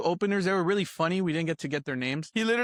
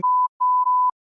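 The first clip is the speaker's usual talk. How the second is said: sudden loud high laugh; loud laugh burst; 8 dB louder than usual. Real, not acted.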